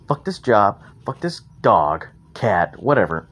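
ordinary talk, nothing marked

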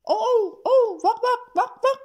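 loud, high howl and barks; big dog's yelps and woofs, acted out; barking dog. A narrator's reading. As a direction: bark like an excited watchdog. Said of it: "پرید و گفت".

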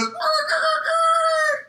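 very high, loud imitated screech; frenzied squawking. Acting out the maniac cook's chicken fit, shrill squawks. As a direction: screech like a frantic bird.

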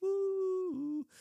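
long, high-pitched appreciative whoop; delighted "ooh". Real, not acted. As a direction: let out a high, drawn-out ooh of relish. Savouring the verse just read.

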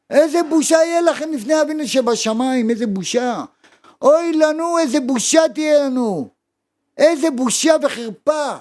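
high, loud fiery rebuke; preaching at full force. Real, not acted. Preacher rebuking his listeners about shame, fiery and loud. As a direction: thunder the shame at them.

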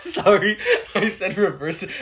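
laughing through the words. Real, not acted. Laughing at her own slip, real laughter. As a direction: giggle while apologizing.

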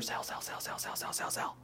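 urgent whispered chant of sell. Retold or imitated. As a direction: hiss it under your breath like a trader hitting the button. Mimics frantic selling at computer.

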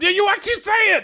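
very high, shouted delight; thrilled amazement. Retold or imitated. Tourist hearing a real mamma mia, thrilled amazement. Recalls excited reaction in Italy.

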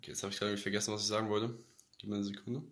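murmured softly while searching for words; quiet, distracted aside; trailing off. Real, not acted. Forgot what he wanted to say.

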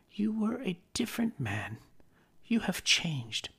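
put-on a young woman letting go of her fiancé; Belle, sorrowful. Belle parting from young Scrooge.

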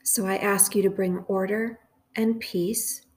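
slow, measured prayer with long pauses; guided meditation prayer. Real, not acted. Host leading listeners through a prayer for confusion, measured meditation. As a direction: lead a meditation phrase by phrase, leaving room to repeat.

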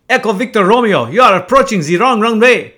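put-on loud, high French-accented yelling; angry French accent. French controller yelling at the pilot on the radio, angry French accent. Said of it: "I gotta do that in French accent".

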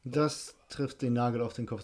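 ordinary talk

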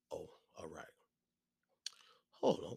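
unvoiced whisper to someone nearby; whispered aside. Real, not acted. Comedian whispering an aside to someone in the room, hushed. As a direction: breathe it off-mic.

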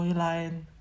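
normal talking voice

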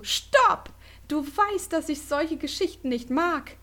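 screaming. A narrator's reading. Heidi screams at Yui to stop the ghost story, loud high shout. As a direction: shriek it, hands over her ears. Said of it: "schrie sie".

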